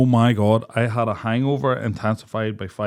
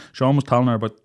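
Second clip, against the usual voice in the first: ordinary talk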